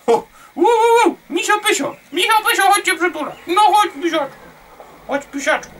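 high-pitched cooing calls; excited calling. Pretend. Gamer inviting Misio Pysio for a hug, excited calling. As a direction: call out high, as to a pet.

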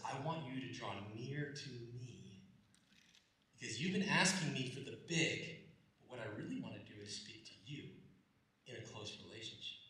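low and hushed, tender; soft voice of God. Acted. God invites the listener near, softly. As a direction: speak it close and quiet.

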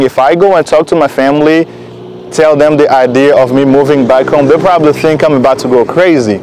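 ordinary talk

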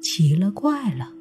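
ordinary delivery

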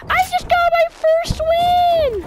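very high, slow, loud squeal; mock triumphant yell. Teasing Connor about a player's win, mock ecstatic squeal. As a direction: imitate him in a drawn-out high shriek. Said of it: "he's like".

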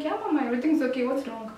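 rushed, fast yeah mama; hurried innocent denial to mom; quick reassurance. Retold or imitated. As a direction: brush it off fast, a bit too quick. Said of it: "I'm like".

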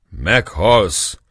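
shouted threat. A narrator's reading. Former prisoners yelling at the Turk, loud. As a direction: yell the death threat. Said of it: "kiáltották a volt rabok is".

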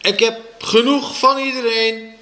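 put-on high, fed-up child's cry; riep. A narrator's reading. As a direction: yell it, sick of everyone. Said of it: "riep ik". (boos en hoog geroepen)

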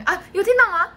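ordinary delivery

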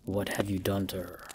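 low, soft and tense; soft menacing hiss. A narrator's reading. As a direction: hiss it through the teeth. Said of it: "I hissed".

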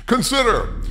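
normal talking voice

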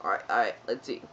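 dropped low to sound badass; trying out a badass low voice for the outro; deep voice. Shown as a demonstration. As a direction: go way down low and cool. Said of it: "let me say that with my voice".

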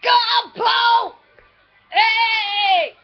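high, loud whooping encouragement; shouted cheering. Real, not acted. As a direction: shout the pet on excitedly. Cheering the pets on.

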